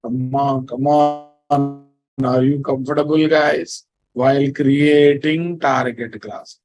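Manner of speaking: ordinary talk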